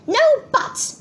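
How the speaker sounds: high, sharp refusal; shouted scolding. A narrator's reading. Mum cuts off Peter's excuse, high shout. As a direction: cut him off with a high shout. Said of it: "shouted Mum".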